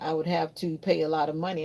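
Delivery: ordinary talk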